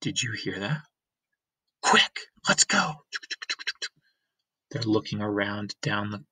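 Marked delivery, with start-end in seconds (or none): 0.0-3.1 fast frightened whisper; urgent whisper. Acted. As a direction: hurry away, whispering. Fleeing after hearing noise.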